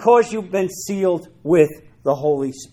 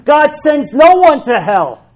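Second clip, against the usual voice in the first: forceful retort; 7 semitones higher, 7 dB louder than usual. Acted. Rebuttal preached with force.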